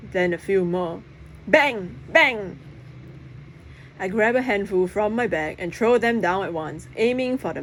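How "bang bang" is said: sharp bang sound effect; popping bang. A narrator's reading. Narrator voicing poppers going off bang. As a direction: pop it like a firecracker.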